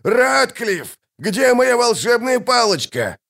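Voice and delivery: high-pitched put-on wizard's call; high fussy shout; agitated cry. A narrator's reading. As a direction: demand it in a high, frantic voice. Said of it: "крикнул Доррогарай".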